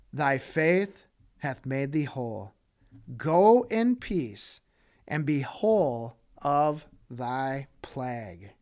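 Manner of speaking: slow, gentle blessing; tender Jesus. Acted. Jesus speaks tenderly to her.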